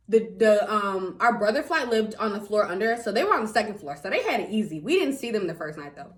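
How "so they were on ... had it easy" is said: usual speaking voice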